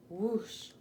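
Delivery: plain reading voice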